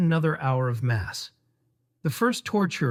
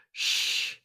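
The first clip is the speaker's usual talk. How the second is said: unvoiced hushing shush; 6 dB quieter than usual. Mom shushing her sons during mass, breathy unvoiced shush. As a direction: hush the boys sharply in church. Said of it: "Mom says".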